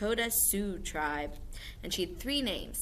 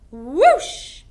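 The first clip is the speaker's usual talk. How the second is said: loud rising whoosh; ghostly whoosh; 8 semitones higher, 15 dB louder than usual. Shown as a demonstration. Teacher shows the class a ghost swooping. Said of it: "Ghosts swoop".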